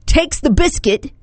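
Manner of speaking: high amateur British accent; attempted British accent. Shown as a demonstration. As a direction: try the phrase as a would-be Brit. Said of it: "say these all with a British accent".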